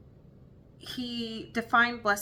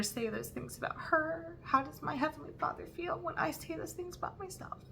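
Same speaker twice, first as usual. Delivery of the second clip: near tears; 10 semitones higher, 6 dB quieter than usual. Real, not acted. Telling her viewers about her daughter, fighting real tears. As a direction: voice pitched high and tight, close to crying. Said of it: "I'm trying not to cry".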